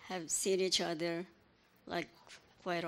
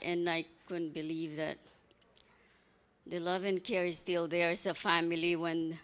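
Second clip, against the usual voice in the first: ordinary delivery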